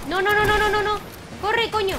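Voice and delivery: loud, high panicked no's; panicked shouting. Real, not acted. Panic as enemy approaches. (gritos de pánico)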